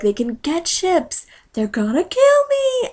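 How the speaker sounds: high, drawn-out mock panic; panicked king. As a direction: voice climbs into a whiny freak-out. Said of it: "freaking out 'cause he's like".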